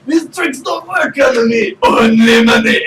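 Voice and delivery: high, loud, slow proclamation; mock indignant shout. Replying to a player about tricks, hammed-up high shout.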